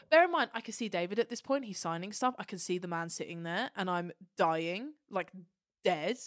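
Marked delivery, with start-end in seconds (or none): none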